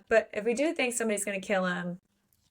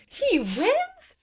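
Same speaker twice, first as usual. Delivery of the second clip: put-on very high, incredulous child's squeal; screaming little boy. Host quotes the boy hearing the villain lives, mock screaming. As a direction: imitate an outraged boy, voice leaping up. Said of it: "little boy in The Princess Bride who is screaming".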